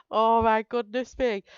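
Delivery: normal talking voice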